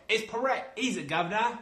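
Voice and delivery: high-pitched hammed-up British henchman; mock British movie-villain accent; bad British accent. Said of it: "worst British accent I've ever heard".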